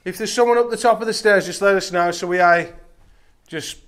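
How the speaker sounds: loud, projected call to a stranger; calling upstairs. Real, not acted. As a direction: shout up the stairs to whoever's there. Calling upstairs to whoever is there.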